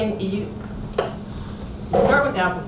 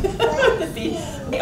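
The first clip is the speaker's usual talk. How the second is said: laughing through the words; 9 semitones higher than usual. Real, not acted. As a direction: chuckle while saying it. Laughs about growing up on beef.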